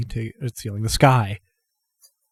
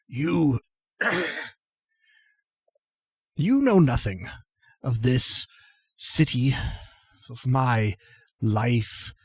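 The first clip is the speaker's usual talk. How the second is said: put-on defiant sick old man; 2 semitones higher, 4 dB quieter than usual. Ailing grandfather rebuffs his accuser, halting and defiant. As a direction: push out proud words between coughs, fragment by fragment. Voices coughing old Croesus.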